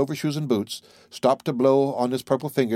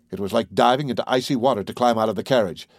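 ordinary delivery

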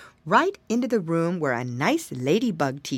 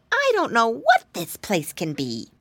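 little pig voice; 5 semitones higher than usual. Acted. Pig character in story.

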